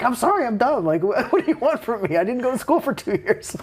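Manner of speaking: high-pitched, laughing mock plea; protesting apology. Son pleads with his mad dad after the test, mock protesting. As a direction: retell a helpless protest to an angry parent.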